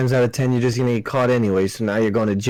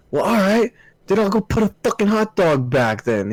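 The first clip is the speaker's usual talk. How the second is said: higher-pitched, mildly indignant quote; mock indignant customer; 8 semitones higher than usual. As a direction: lightly imitate a miffed customer. Acting out an arguing customer.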